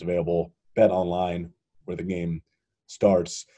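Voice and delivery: smooth sponsor ad read. Acted. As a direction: read it like a radio spot. Reads a sponsor ad.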